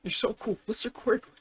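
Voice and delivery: high-pitched starstruck gushing; excited fanboying. Acted. Izuku meeting his idol Stain the hero killer, excited fanboying. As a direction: gush questions like a thrilled fan. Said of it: "Izuku starts fanboying about it".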